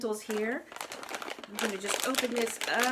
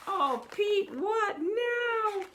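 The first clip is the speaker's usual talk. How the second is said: mock dismay; 10 semitones higher, 4 dB louder, much slower than usual. Narrator dismayed at Pete.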